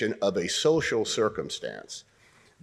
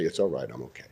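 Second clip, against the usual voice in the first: embarrassed brush-off; 10 semitones lower, 5 dB quieter, faster than usual. Shown as a demonstration. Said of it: "and you'll say".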